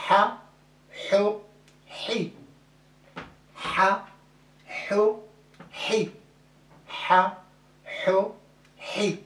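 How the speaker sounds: Ha, ho, hi breathed from the throat; throaty ha ho hi. Shown as a demonstration. Teacher drilling vowel series aloud. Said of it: "c'est hi".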